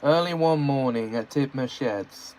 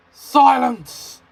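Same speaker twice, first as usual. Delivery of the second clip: high loud sharp command; snapped; 11 semitones higher, 8 dB louder than usual. Acted. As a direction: cut them off. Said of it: "snapped the Fat Controller".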